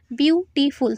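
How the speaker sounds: বিউটিফুল in three distinct beats, biu-ti-ful; syllable by syllable. Shown as a demonstration. Teacher counting syllables for students, বিউটিফুল said in three parts. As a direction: separate each syllable of বিউটিফুল so it can be counted. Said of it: "তিনটে syllable চলে আসছে".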